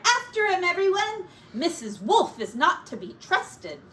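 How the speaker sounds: high, loud call to the chase; rally the piglets urgently; rallying cry. Acted. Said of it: "Sorrel cried".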